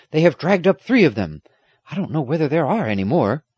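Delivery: lively running report from the squire; exclaiming. A narrator's reading. Squire reporting the rescue, exclaiming. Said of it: "the squire exclaimed".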